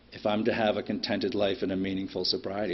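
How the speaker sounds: ordinary talk, nothing marked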